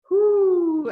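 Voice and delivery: loud, high, falling hoots; owl hooting. A narrator's reading. Reader voicing the owl for a child, loud hoots. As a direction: hoot long and loud like an owl. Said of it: "asked the owl".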